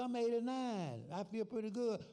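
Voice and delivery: put-on high, loud old man greeting a friend. Old friend hailing Sam in Jackson, high old man voice. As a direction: pitch it up like a chirpy old timer calling out.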